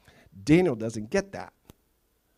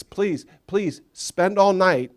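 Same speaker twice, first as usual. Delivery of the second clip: slow pleading; 4 semitones higher, 3 dB louder, slower than usual. Retold or imitated. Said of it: "he asks".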